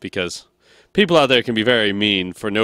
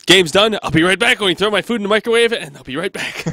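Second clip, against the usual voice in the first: high, loud, quick call-out; playfully frantic heads-up; hurried announcement; 9 semitones higher, 4 dB louder than usual. Acted. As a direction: blurt it out as if dashing off. Acts hurried break announcement on cast.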